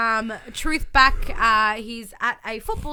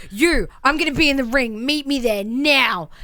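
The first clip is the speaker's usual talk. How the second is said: mock challenge; 3 semitones higher, 7 dB louder than usual. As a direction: call him out to the ring. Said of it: "Mike's just like".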